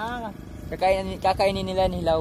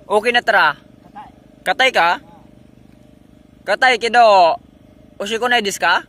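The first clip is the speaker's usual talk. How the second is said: much louder, raised-pitch calling; pasigaw na pagtawag; 5 semitones higher, 13 dB louder than usual. Real, not acted. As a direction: shout the questions across. Calls out questions to companion. (malakas na pasigaw na pagtatanong)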